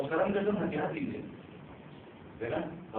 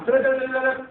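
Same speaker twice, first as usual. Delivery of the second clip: camera click noises; 9 semitones higher, 6 dB louder, much faster than usual. Retold or imitated. Mimicking camera shutter clicks.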